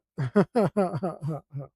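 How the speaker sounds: sheepish laugh. Real, not acted. Laughs again after denial.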